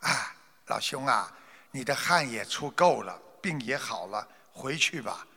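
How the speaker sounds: gentle doctor. Acted. Li Shizhen gently tells the merchant to go home. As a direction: gently tell him he's cured. Kindly doctor reveals his cure.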